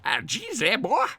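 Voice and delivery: high-pitched mock Southern American; bad American accent. Streamer retrying the Southern line, high bad American accent. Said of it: "I'm not very good at American accents".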